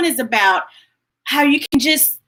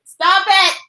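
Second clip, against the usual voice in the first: "Stop it." higher and louder, pushing back with a laugh; real playful protest; 8 semitones higher, 6 dB louder than usual. Real, not acted. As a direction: tell the viewer to stop it, touched and teasing. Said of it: "You gonna make me cry".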